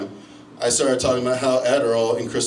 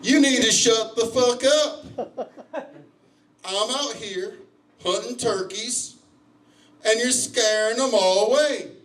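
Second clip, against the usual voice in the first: slow, high-pitched hunter voice; irate hunter; 11 semitones higher, slower than usual. Retold or imitated. Turkey hunter scolding the runner, slow high complaint. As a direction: scold slowly in a high put-on voice. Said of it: "points at me and says".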